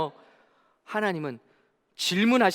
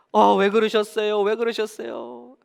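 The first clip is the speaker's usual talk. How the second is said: pleading Job; 4 semitones higher, 5 dB louder than usual. Acted. 욥이 하나님께 묻는 장면.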